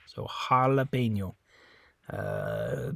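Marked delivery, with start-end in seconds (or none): 0.1-1.4 "jalapeño" with the J as an H; Spanish J in jalapeño. Shown as a demonstration. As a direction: say "jalapeño" as ha-la-PEN-yo. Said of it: "an English H sound".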